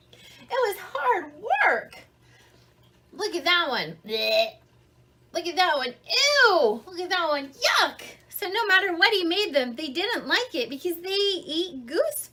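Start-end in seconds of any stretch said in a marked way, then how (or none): 4.1-4.6 a grossed-out gagging blech; disgusted blech. Acted. Goslings tasting bear food, disgusted. 6.1-6.8 loud, high grossed-out ew; disgusted ew. Acted. Goslings tasting bear food, loud disgust. 7.5-8.0 high grossed-out yuck; disgusted yuck. Acted. Goslings tasting bear food, high disgust.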